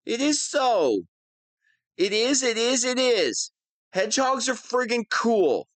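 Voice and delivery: high, petulant insistence; whiny defensive. Acted. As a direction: defend your name like a sulky child. Villain defends mocked name.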